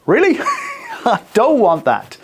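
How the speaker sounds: high squeaky mock "Really?"; mock disbelief. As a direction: tease like a doubting crew. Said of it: "the production team are going".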